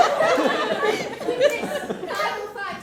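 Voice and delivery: very slow, loud laughing through the words. Real, not acted. Confessing to the inspector, laughing hard. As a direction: struggle to get the line out laughing.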